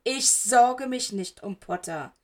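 growled. A narrator's reading. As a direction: growl the denial through the teeth. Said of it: "knurrte Severus". (langsam und lauter geknurrt)

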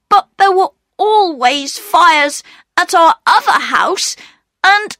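put-on very high, halting boy's voice; pleading Peter. A narrator's reading. Peter excusing himself to the station master, high and pleading. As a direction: explain yourself anxiously in a high child's voice.

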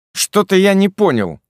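loud and high bewildered blurt. A narrator's reading. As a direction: bark it out confused. Kirill bursts in.

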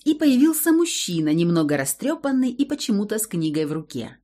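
plain reading voice

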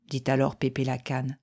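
plain reading voice

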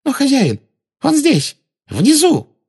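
very high frantic witch voice; urgent Bellatrix. A narrator's reading. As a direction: point him out desperately. Bellatrix urges master.

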